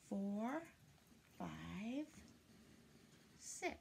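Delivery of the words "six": ordinary delivery, nothing marked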